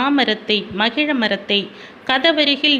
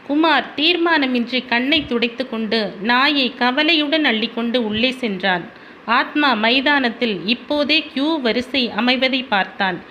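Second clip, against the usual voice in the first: usual speaking voice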